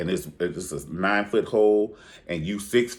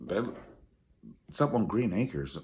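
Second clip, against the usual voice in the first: ordinary talk, nothing marked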